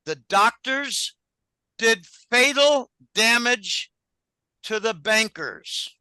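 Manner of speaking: slow, deliberate, every word weighed; slow emphatic statement. Real, not acted. Driving home a grim point to the interviewer, slow and deliberate. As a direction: spell out fatal damage slowly so it lands. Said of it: "Let that sink in".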